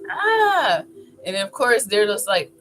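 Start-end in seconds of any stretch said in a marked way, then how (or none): 0.1-0.8 high mock werewolf "Ah"; playful high-pitched yell; werewolf cry. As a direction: let out a high, drawn-out pretend monster cry. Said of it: "and he's like".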